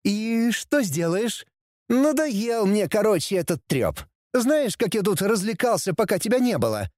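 high-pitched bratty taunting; cheeky prince. A narrator's reading. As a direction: provoke your father in a high voice. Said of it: "Вдруг поинтересовался принц". (дерзкий принц, высоким голосом)

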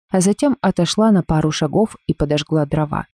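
ordinary delivery

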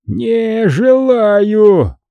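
very slow, high drawn-out bellow; drunken roar. A narrator's reading. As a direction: stretch it out like a stubborn drunk. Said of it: "ревёт монах".

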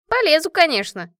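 high, loud ready assent; eager agreement. A narrator's reading. Машка agrees to dive for her country, emphatic. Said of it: "согласилась Машка".

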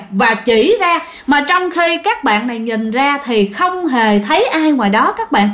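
usual speaking voice

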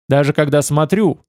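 ordinary delivery, nothing marked

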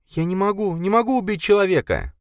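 high, desperate woman's voice; desperate refusal. A narrator's reading. Linda refusing to shoot a man, desperate. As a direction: refuse in panic.

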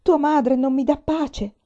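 distraught outburst, high and loud; sobbing. A narrator's reading. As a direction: lament loudly, as if through tears. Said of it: "singhiozzò Peonia".

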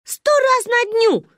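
put-on high, loud, drawn-out kid's brag; defiant boast. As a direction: belt it out defiantly. Child boasts defiantly in argument. (с вызовом, громко, нараспев)

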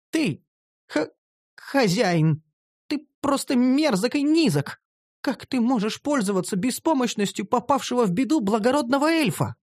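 high indignant elf, stammering master; outraged elf girl. Acted. Elf girl calls her master vile, high and outraged. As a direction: spit out the accusation.

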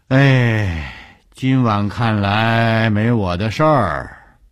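put-on high, loud old man's drawn-out sigh of words; old morgue keeper. A narrator's reading. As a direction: call it out slowly like an old caretaker. Old morgue keeper talking, looking up.

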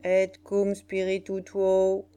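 slow, sustained liturgical monotone; solemn. A narrator's reading. Mysterious Obi adding the church response, solemnly. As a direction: intone it on one held note. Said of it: "ajouta solennellement le mystérieux Obi".

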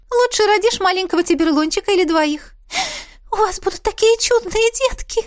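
high, excited chatty mother; mother gushing. Acted. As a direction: gush like a thrilled mother. Mother dreaming of grandkids. (восторженная мама, высокий голос)

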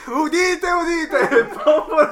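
loud, high proclamation through laughter; town crier. Acted. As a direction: announce it like a herald to the square. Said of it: "stai facendo la cavalleria rusticana?".

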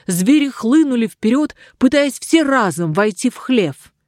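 plain reading voice

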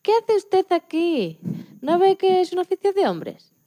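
a hint of a scornful boss; scornful man's retort. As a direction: imitate a condescending colleague turning her away. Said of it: "la frase que con la que se me recibió".

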